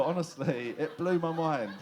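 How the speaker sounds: normal talking voice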